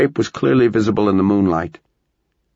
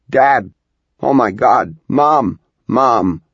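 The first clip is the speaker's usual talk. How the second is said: calling out; 4 semitones higher, 4 dB louder, much slower than usual. Acted. Child calling for help.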